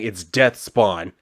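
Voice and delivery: "It's Death Spawn" said slow and deliberate, each word separate; correcting her name slowly; slow correction. Retold or imitated. Dad corrects his daughter, slowly spelling out Death Spawn. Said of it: "he corrects her by saying".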